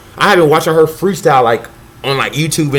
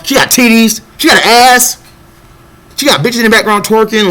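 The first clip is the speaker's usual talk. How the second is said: louder, higher, building excitement; rising hype; 7 semitones higher, 4 dB louder than usual. Real, not acted. Listing the rapper's assets to the audience, building hype. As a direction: pile it on, getting more hyped each line.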